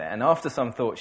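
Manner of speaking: usual speaking voice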